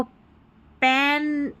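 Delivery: पेन said very slowly, vowel drawn out; slow model word. Shown as a demonstration. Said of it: "पेन पढ़ना है". (पेन को बहुत धीरे, खींचकर बोलें)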